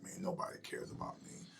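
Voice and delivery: a hushed, broken inner voice; hopeless murmur. Retold or imitated. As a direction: breathe it out like nobody cares. Recalling despair after divorce.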